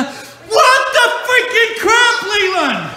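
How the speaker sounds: high, slow-stretched exasperated yell; shocked outburst. Acted. Dad discovering what Leland has done, shocked outburst. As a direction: blow up in comic disbelief. Skit dad startled by boxes.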